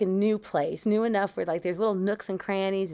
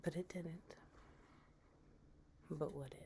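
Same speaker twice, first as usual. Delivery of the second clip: hushed horror narration; 4 semitones lower, 20 dB quieter than usual. A narrator's reading. Storyteller leaving listeners with what if, hushed. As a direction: let each eerie thought hang in a hush. Suspenseful ghost story ending.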